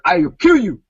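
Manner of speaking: mock African accent, menacing. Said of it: "in his African accent".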